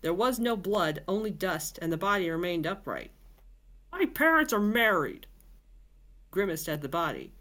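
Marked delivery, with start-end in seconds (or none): 3.9-5.3 indignant. Acted. Boris insists after the kill.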